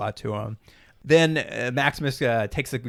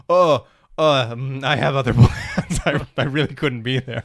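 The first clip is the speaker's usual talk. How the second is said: awkward, halting fake excuse; imitating a kid squirming out of an invitation; bad liar; 4 dB louder than usual. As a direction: fumble for an excuse with a laugh slipping in. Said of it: "everyone can't lie to Maximus. They're so bad at it".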